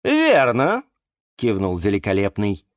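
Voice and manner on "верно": higher, self-important showman voice; puffed-up flower wizard laying down rules; pompous wizard. A narrator's reading. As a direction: play the grand hyacinth magician. Eduard nods.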